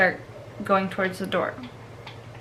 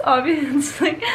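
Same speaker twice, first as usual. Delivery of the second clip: laughing; 6 semitones higher, 4 dB louder, slower than usual. Real, not acted. Laughing at own comparison.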